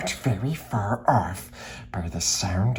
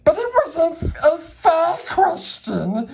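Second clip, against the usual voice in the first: much higher creature whine; Gollum protesting; 4 dB louder than usual. Acted. Gollum protests to Bilbo in a much higher whine.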